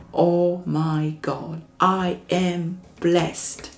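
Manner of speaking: very slow, drawn-out amazed exclamation; slow, amazed oh my God; awed realization. Acted. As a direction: savor the amazement of being blessed.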